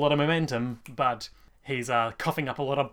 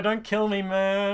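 whiny pleading; 6 semitones higher, slower than usual. Retold or imitated. Jojo pleading for his life.

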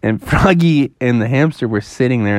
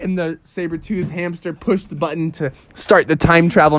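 usual speaking voice

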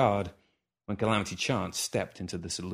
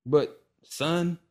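cutting in; 7 semitones higher than usual. Acted. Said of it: "the old man cut in".

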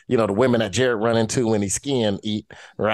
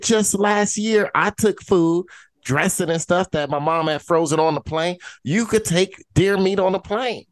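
heated retort; 8 semitones higher than usual. Retold or imitated. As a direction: set him straight, worked up. Arguing with dad about meat.

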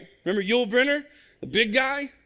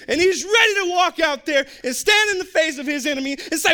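normal talking voice

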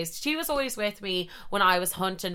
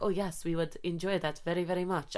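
much softer, formal prim reply; imitating polite French boys; 8 dB quieter than usual. French boys accepting the invitation, soft stiff politeness.

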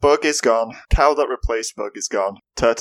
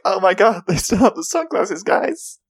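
mock excitement; 8 semitones higher than usual.

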